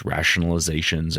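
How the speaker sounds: ordinary talk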